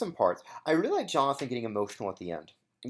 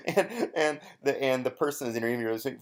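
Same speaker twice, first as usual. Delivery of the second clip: ordinary talk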